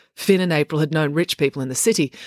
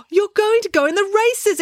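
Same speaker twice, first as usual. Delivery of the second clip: put-on very high, louder, thrilled girl; delighted exclaim; 4 dB louder than usual. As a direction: Loretta squealing with glee at the news. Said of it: "exclaimed Loretta".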